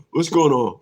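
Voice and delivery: usual speaking voice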